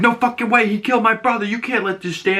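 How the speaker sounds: a son protesting his brother's killer going free; imitating angry protest. Keith protesting to Augie, high mock-angry voice. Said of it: "And Keith's like".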